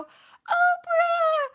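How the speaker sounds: high, loud, drawn-out fan scream; full-voiced, high-pitched screams stretched long; starstruck screaming. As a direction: shriek it out like an uncontrollable fan. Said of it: "I'm screaming and shouting".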